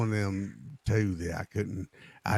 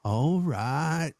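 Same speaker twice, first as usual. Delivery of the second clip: higher, drawn attempt at English "All right"; imitated English accent; 6 semitones higher, 4 dB louder than usual. Trying to be one of y'all, imitated English accent. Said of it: "I think I got it down".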